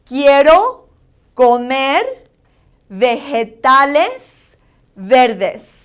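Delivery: teacher repeating "Quiero comer vegetales verdes" with a pause after each word; accentuated Spanish vowels. Shown as a demonstration. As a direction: stretch "vegetales" slowly, drop on "verdes". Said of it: "say that one again 'cause that's a little longer".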